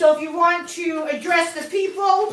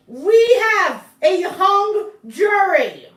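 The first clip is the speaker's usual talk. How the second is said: verdict announcement; 5 semitones higher, 8 dB louder, much slower than usual. Acted. Announcing the mock trial verdict.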